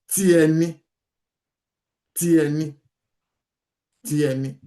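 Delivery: "Ti ẹni" repeated, fading; teacher repeating. Shown as a demonstration. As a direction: drill "Ti ẹni" aloud with no R. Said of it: "you normally will say".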